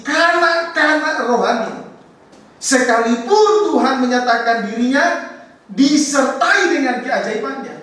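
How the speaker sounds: high, loud preaching climax; fervent preaching. Real, not acted. Pastor driving the point home to the congregation, high and loud. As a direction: press the point hard on the congregation.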